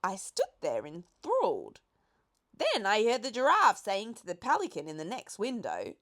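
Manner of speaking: usual speaking voice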